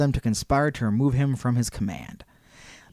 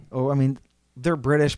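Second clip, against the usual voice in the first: ordinary talk, nothing marked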